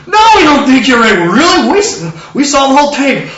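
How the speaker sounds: high, loud acted protest; emphatic denial. Answering Joseph's worry, loud mock-incredulous denial. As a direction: insist on it at full voice. Acts emphatic reassuring reply.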